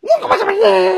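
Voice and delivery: put-on very high, loud little-kid squeal; mocking child voice. Son throws father's complaint back, high mocking voice. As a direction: parrot it back in a piercing child's voice.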